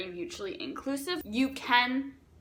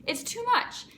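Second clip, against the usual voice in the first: high-pitched, exasperated exclamation; exclaimed; 13 semitones higher than usual. Shown as a demonstration. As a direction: pitch shoots way up on "much". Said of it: "with an exclamation point and capital letters".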